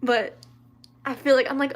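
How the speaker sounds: ordinary talk, nothing marked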